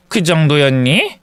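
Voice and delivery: ordinary delivery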